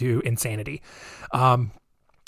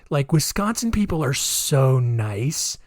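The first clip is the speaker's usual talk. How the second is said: normal talking voice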